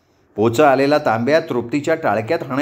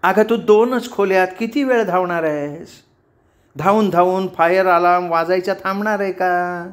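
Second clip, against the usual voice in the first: higher, nagging exasperated questions; mild mock yelling; 4 semitones higher than usual. A narrator's reading. Roommate scolding the other for running around, yelling. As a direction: snap with contained volume. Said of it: "कोण कोणावर खेकसतंय आणि ओरडतंय".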